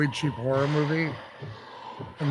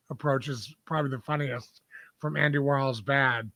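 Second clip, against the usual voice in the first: normal talking voice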